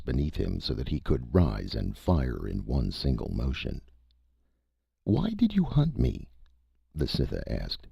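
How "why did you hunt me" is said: high, curious alien voice; alien creature voice. A narrator's reading. The Cytha asks the hunter why, high and curious. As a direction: ask it high and wondering.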